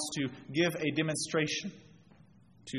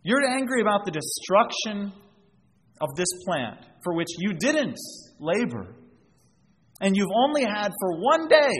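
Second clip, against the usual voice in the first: God questioning Jonah; 5 semitones higher, 4 dB louder than usual. Retold or imitated. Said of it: "God is asking Jonah".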